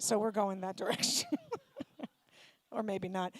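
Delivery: ordinary talk, nothing marked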